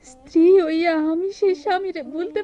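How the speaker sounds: loud, pained lament; anguished plea. Acted. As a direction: cry out her heartbreak. Sister pleads emotionally. (আর্তস্বরে বেদনাভরা Minati)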